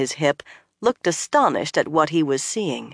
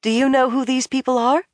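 higher, worried question; uneasy; 8 semitones higher than usual. A narrator's reading. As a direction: ask with rising unease. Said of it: "Egwene asked uneasily".

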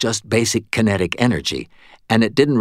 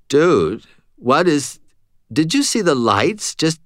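confused stoner; 7 semitones higher than usual. A narrator's reading.

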